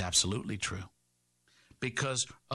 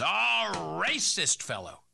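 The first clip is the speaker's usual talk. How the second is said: mock media sneer; 5 semitones higher, 4 dB louder, much slower than usual. Mocking the national media.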